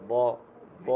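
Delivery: "ব" with a thick, heavy sound; thick Arabic letter. Shown as a demonstration. Teacher showing students the heavy letter, thick "ব". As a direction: say "ব" full and heavy. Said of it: "এটা একটু মোটা হবে".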